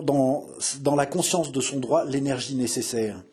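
usual speaking voice

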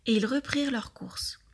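ordinary delivery

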